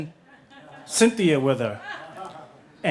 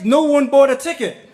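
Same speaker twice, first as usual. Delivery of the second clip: normal talking voice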